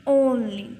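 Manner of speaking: "only" as OHN-lee, loud and falling; teacher confirming the oh in "only"; long O sound. Shown as a demonstration. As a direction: repeat "only" with a firm long oh. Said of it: "ओ की sound आएगी".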